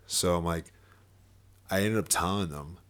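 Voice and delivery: ordinary delivery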